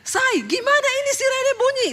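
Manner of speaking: high, panicky-sounding mock yell; husband's shout. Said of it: "Suami saya teriak". (teriakan suami yang ditirukan, bernada tinggi)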